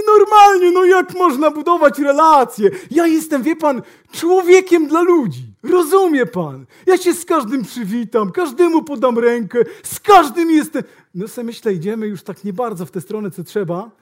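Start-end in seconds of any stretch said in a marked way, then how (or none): 0.1-10.8 mock boasting, high and loud; hammed-up loud self-praise; loud bragging salesman. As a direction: bellow it like a proud salesman. Salesman brags about relationships.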